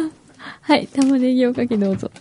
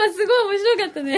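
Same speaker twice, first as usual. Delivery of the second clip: high-pitched laughing comment; talking through tears of laughter; laughing hard; 8 semitones higher than usual. Real, not acted. Reacting to the snack thief.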